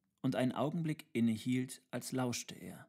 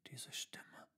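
very faint, breath-only whisper; 13 dB quieter than usual. A narrator's reading. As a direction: barely whisper it, listening out. Tutor repeats, listening intently. (kaum hörbar gehaucht)

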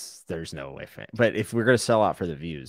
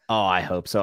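ordinary talk

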